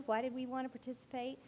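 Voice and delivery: ordinary delivery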